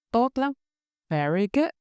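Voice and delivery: ordinary talk, nothing marked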